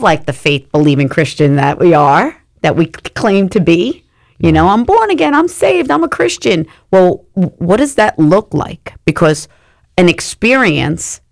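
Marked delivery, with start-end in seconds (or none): 4.7-6.7 much higher, mock-proud voice; mock pious claims. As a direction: parody a smug believer's boast. Mocking churchgoers' self-claims.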